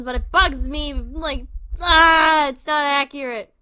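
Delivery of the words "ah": loud, frustrated "ah"; exasperated yell. Real, not acted. Fan vents at an inaccurate horror detail, exasperated yell. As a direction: burst out with a frustrated cry.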